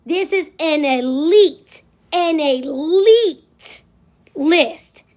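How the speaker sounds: elite drawn out slowly and stressed. Retold or imitated. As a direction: lean hard on elite, very slowly. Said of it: "The lady said".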